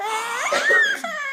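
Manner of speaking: big high mock gasp for the baby; playful gasp. Gasping high to amuse the toddler. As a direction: gasp up high like it's shocking.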